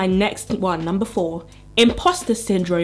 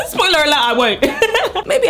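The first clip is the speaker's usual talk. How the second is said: laughing; 10 semitones higher, 5 dB louder than usual. Real, not acted. Laughing at own joke.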